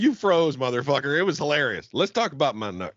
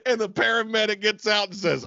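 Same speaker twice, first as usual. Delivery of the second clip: usual speaking voice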